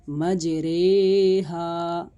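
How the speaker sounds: मजरेहा slowly recited with a long drawn-out रे; Quran recitation with imala. Shown as a demonstration. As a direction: chant मजरेहा stretched out, the रा said as रे. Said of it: "बल्कि मजरेहा".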